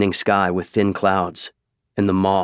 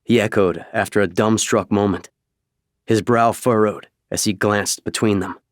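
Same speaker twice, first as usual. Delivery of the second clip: ordinary delivery, nothing marked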